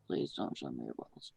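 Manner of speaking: low rumbling repeat. Acted. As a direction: drop the voice way down. Second take, much lower.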